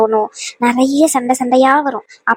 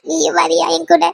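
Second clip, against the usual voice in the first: tearful plea; 3 semitones higher than usual. Acted. Crying bride begs mother to come.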